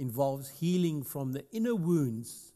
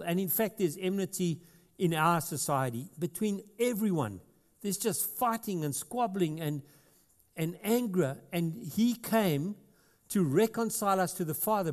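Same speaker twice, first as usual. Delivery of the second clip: fervent pulpit delivery; preaching at full force; 5 semitones higher than usual. Acted. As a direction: proclaim it over the congregation. Sustained raised preaching.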